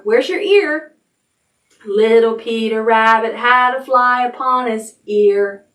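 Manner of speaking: ordinary talk, nothing marked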